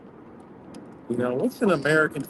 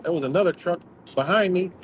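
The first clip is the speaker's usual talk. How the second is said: ordinary delivery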